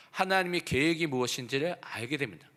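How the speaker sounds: normal talking voice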